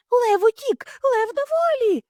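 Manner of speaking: very high, loud alarm; shouting. Acted. Hares yell the alarm through the zoo. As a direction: yell the warning everywhere. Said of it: "кричали вони".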